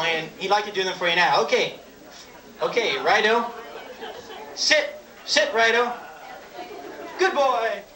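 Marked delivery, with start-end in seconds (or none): 4.5-5.9 dog command voice. Acted. Commanding pet loaf of bread. 7.2-7.8 praising a pet. Acted.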